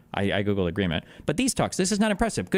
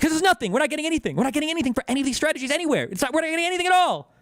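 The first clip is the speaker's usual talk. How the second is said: exasperated outburst; 13 semitones higher, faster than usual. Real, not acted. Streamer ranting at chat about empty deals, exasperated. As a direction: let the frustration boil over.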